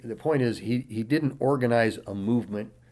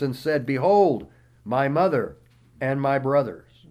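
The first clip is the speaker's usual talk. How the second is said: raised, declaiming Bible reading voice; scripture reading; 4 semitones higher, 6 dB louder than usual. Acted. Pastor reading Matthew aloud to the congregation, declaiming. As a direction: read the verses out like a preacher in full flow.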